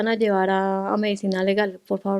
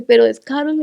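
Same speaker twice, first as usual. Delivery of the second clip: disbelief; 4 semitones higher, 8 dB louder than usual. Retold or imitated. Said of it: "Yo dije".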